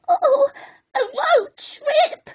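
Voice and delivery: high, frightened little crab voice; quailing. Acted. Snippy quailing in the van, high and scared. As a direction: squeak it out in fright. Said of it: "quailed Snippy".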